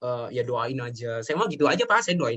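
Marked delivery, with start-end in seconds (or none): 0.0-1.2 evasive reply. Retold or imitated. Son brushing off his mother.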